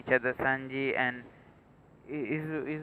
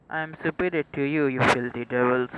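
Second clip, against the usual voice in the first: louder, gloating over a beaten foe; gloating shout; 8 dB louder than usual. Acted. Villain taunts the beaten devil, in a loud gloating voice. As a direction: crow your superiority loud.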